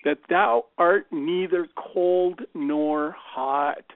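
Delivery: slow, weighty Bible quotation; measured, solemn declaiming of a verse; scripture recitation. Acted. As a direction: recite scripture slowly and emphatically. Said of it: "there's this quote that says".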